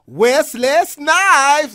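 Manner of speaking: high loud slow yell of the name; loud slow fan shout; drawn-out shout. Retold or imitated. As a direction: stretch out his name in a yell. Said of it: "and then his full name".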